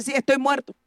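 ordinary talk

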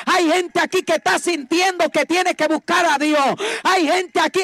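loud, high, shouting sermon; preaching at full force. Acted. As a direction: thunder it at the congregation.

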